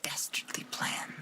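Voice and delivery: hushed villainous plotting; scheming whisper. Pretend. Streamer plotting a sneaky move, mock villain whisper.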